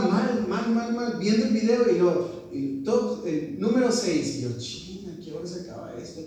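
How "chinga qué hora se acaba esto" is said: very quiet, fast mutter of impatience; exasperated muttering. Retold or imitated. Student to himself, enduring tantrum videos, exasperated mutter. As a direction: grumble it under your breath.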